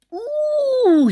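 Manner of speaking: high squealing ooh of rage; a sudden high outraged ooh; cartoonish high cry of anger; furious ooh. Fox furious, shakes fist.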